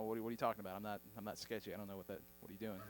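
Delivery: low, very soft flustered stammer; uneasy mumbling. Retold or imitated. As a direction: shrink back, trailing away uneasily. Acts himself uneasy at friend's advice.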